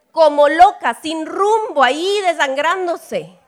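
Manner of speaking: vivid exclaiming. Real, not acted. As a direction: paint the headless hen loudly. Describe gallina descabezada. (exclamando fuerte y agudo, dramático)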